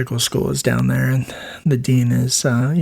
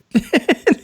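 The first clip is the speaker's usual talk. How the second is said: laugh; 12 semitones higher than usual. Real, not acted. Laughs at called him dude.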